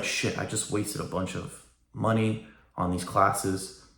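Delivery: soft, slow, deflated groan of regret; dejected regret. Retold or imitated. Imagined graduate realising wasted tuition, softly deflated. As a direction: let it sink in quietly, like realising the money is gone.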